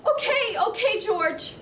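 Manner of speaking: frightened. Acted. Clarence caves to choking threat.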